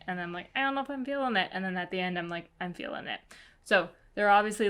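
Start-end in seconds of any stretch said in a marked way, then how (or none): 0.6-1.5 high-pitched wavering quote; unsure. Retold or imitated. Reader reenacting her swing back on the book, unsure. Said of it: "kind of a roller coaster". 2.6-3.2 softer, settled quote; won over. Retold or imitated. Reader reenacting her final verdict on the book, won over. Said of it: "kind of a roller coaster".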